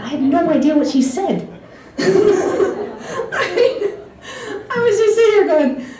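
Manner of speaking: high, giggly confession; real laughter breaking through the words; laughing while talking. Real, not acted. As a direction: crack up as you admit it. Laughing at her own mistake.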